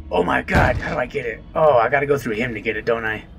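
ordinary talk, nothing marked

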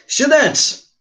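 high-pitched call to the class. Real, not acted. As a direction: call "Students" up high to get attention. Teacher calls the class to attention.

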